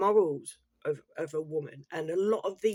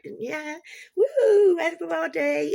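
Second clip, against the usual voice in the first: high, loud hammed-up party cheer; mocking a mum partying for her followers; mock party whoop; 11 semitones higher, 8 dB louder, slower than usual. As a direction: whoop at the camera like a TikTok show-off. Mum dances to the camera.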